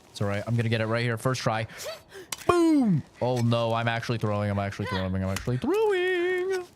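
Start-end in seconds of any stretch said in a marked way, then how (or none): 2.5-3.0 high, loud, falling "boom"; triumphant boom. Real, not acted. Triumphant move in game. 5.1-6.6 high, stretched whine on "throwing"; mock despair. Real, not acted. Same words said thrice.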